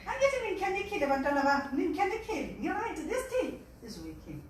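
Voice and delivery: put-on high, fast, loud excited old woman; excited granny. Granny telling her grandchildren it works, high and fast. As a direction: gush it out like a thrilled granny. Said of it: "She said".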